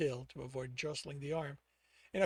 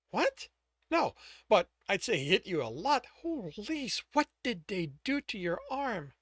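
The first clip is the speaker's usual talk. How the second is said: woman's voice; 6 semitones higher, 5 dB louder than usual. Acted. Woman's voice, worried.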